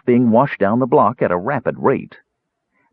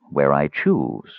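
lower, slow, curt answer; curt mage; 5 semitones lower, slower than usual. A narrator's reading. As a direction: close the subject slowly. Raistlin voiced low, slow.

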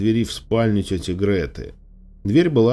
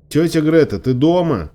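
higher, louder call through the house; raised searching shout; 7 semitones higher, 4 dB louder than usual. A narrator's reading. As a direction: call out to find someone at home. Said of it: "громко позвала я".

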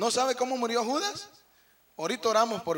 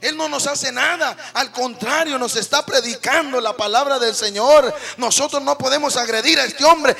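higher, louder shouting; retold yell; 3 semitones higher, 8 dB louder than usual. Rescuer yelling at the fallen drunk, loud. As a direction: shout it in defence of the preacher. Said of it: "le gritaba el borrachito".

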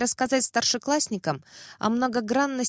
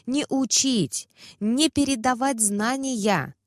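slow emphatic; 4 semitones higher, slower than usual. Acted.

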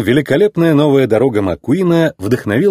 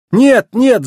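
high, louder cry of protest; alarmed shout; 12 semitones higher, 5 dB louder than usual. A narrator's reading. McQueen dodges the fire truck's jet, alarmed shout. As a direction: shout it in panic. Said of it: "закричал Маккуин".

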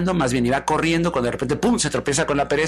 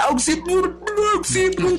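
very high, gurgling yells; drowning cry for help; slower than usual. Acted. Love sinking while calling out, comic drowning cry. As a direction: call out as water fills the mouth. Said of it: "se empieza a hundir mientras grita".

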